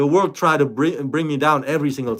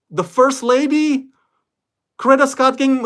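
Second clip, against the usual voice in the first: incredulous; 11 semitones higher, 4 dB louder than usual. Real, not acted. Incredulous at the demand.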